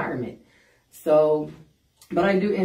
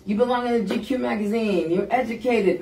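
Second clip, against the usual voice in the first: higher-pitched mock flattery; sarcastic; 6 semitones higher than usual. Inmate taunting the handsome lieutenant, sarcastic. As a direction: lay on the sarcastic admiration.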